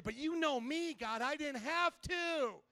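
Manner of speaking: lightly acted self-justifying mom; mock pleading mother. Mother pleads her case to God.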